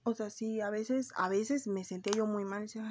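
ordinary talk

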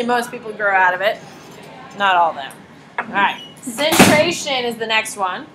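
ordinary talk, nothing marked

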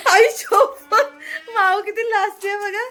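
high laughing call, half crying with laughter. Real, not acted. Mother laughing at shy daughter. (हसत हसत हाक मारणे)

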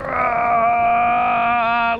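ordinary delivery

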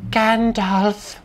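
high, strained put-on voice; silly high character voice; hammed-up wizard impression. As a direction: squeeze the name out high. Hammed-up Gandalf impression attempt.

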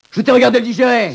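high, loud jab; shouted accusation. Acted. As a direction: throw it in his face.

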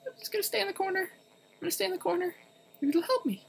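small, high-pitched panicky voice; frightened squeak. Real, not acted. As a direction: squeak it out while hiding in fear. Hiding scared in a corner.

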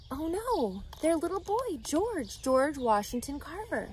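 soft, hushed dismay, a touch of sorrow. Acted. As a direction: sigh it out as if the news hurts. Reader dismayed at kidnapping.

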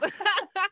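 high bright laugh after the punchline; real amused laugh; burst of laughter. Real, not acted. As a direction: laugh out loud at the joke. Laughing at own story.

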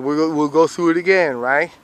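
ordinary talk

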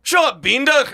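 loud, high, hammed-up Scottish brogue; a deliberately bad, shouted Scottish voice; shitty Scottish accent. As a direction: bellow it like a rowdy Scotsman in a pub. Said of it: "but I can do shitty Scottish".

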